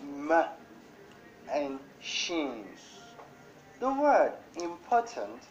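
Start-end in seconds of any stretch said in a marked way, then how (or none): 0.0-2.6 "m" and "shin" said as two separate beats; split into syllables. Shown as a demonstration. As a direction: break "machine" into "m" and "shin" with a pause between. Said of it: "has two parts".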